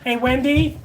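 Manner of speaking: loud call across the house. Real, not acted. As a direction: call out to her from afar. Calling out to Wendy.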